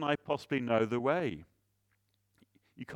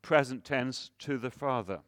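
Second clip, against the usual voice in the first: ordinary delivery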